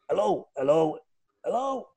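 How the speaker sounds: soft, rising mock voice of an unknown caller; Danny Begora. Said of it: "it was Danny Begora".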